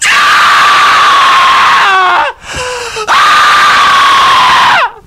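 long, loud, piercing screams back to back; primal scream. Shown as a demonstration. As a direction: scream it all out at full force. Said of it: "I'm gonna do some primal scream therapy".

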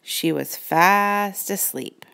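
ordinary delivery, nothing marked

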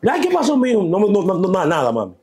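high, loud, hurried denials; retold panicky brush-off; acted flustered reply. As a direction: blurt it out high, as if startled. Acts flustered exchange with his mother.

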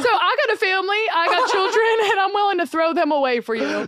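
high, laughing send-up of a smooth talker; mock the cheating husband through giggles; laughing mock Southern man. Mocking the married man's offer.

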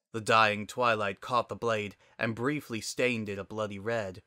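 usual speaking voice

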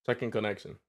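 Checking connection in a quick put-on accent, sh-like ch on "Checking"; light put-on accent on "Checking connection". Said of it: "I said that with an accent".

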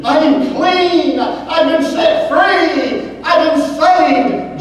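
high, loud, slow jubilant shouts; joyful cries. Acted. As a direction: cry it out in joy, drawn out. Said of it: "the cries of".

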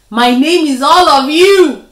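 loud, confident claim; bold claim. A narrator's reading. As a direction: announce it boldly. Spider's trick answer.

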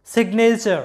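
সিগনেচার with a sounded G closing সিগ before the N; G pronounced. Shown as a demonstration. Teacher explaining the G is sounded, সিগনেচার with audible G. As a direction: let the G be heard in সিগনেচার. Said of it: "এরকম হবে আমাদের উচ্চারণটা".

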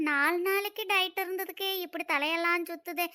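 normal talking voice

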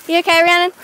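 ordinary talk, nothing marked

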